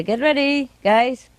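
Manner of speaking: singsong heads-up to the flock; calling out to pets. Retold or imitated. Owner warning her birds lights go off soon, calling out. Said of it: "I say".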